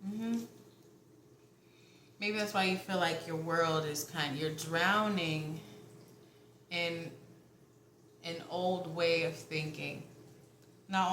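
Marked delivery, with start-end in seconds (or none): 4.4-5.6 "drowning" stretched out slowly; slow stress on drowning. Real, not acted. Reader warning the viewer, slowly stressing "drowning".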